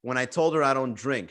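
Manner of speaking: ordinary delivery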